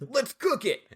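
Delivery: high, eager exclamation; imitated excitement. He tells Ellie his plan, mock excitement. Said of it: "He's just so excited".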